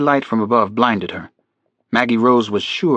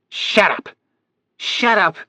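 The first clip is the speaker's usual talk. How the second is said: put-on old woman shouting; 9 semitones higher, slower than usual. A narrator's reading. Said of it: "a voice from above shouted".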